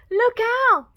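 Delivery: very high, loud warning cry; alarmed shout. Acted. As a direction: shout it as a scared piglet. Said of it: "they shouted".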